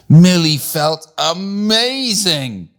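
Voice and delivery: high, drawn-out wonder; elated. A narrator's reading. Narrator telling how Millie felt in new socks, elated. Narrator voices her sudden delight.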